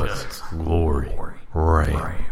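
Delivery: slow, deep, quiet truck ad voice; gravelly cowboy commercial read; Sam Elliott impression. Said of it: "You're gonna talk like Sam Elliott".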